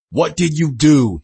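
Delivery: loud, slow angry demand. A narrator's reading. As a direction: demand an answer, leaning hard on the words. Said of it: "he demanded".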